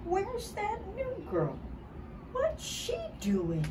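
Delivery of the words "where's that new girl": plain reading voice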